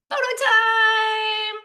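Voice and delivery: high, loud cheer of photo time; excited announcement. Real, not acted. Presenter to audience, cheering the photo slides, high and loud. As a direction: burst out with glee at the slides.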